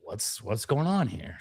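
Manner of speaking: usual speaking voice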